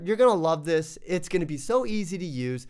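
ordinary talk

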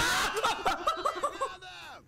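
long, high-pitched cackle; drawn-out gleeful cackle; high laugh. Real, not acted. Long laugh after grabbing ammo.

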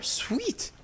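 high delighted squeal of sweet. Real, not acted. Gamer thrilled her character finally jumped, cheering. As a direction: cheer the surprise win.

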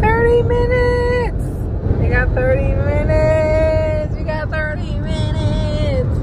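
high, loud, slow excitement; excited. Real, not acted. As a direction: call it out thrilled. Excited about arrival.

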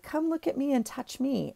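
high, soft tempting voice; pillowcase calling. Retold or imitated. Pillowcase luring the shopper, high and soft. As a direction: coax her over like a temptress. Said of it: "And it was like".

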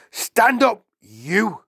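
high, loud command; bullying headmistress yell; shouted order. A narrator's reading. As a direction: shout a pupil to his feet. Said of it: "she shouted at him".

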